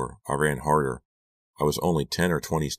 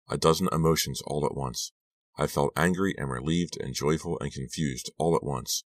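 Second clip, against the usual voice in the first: ordinary delivery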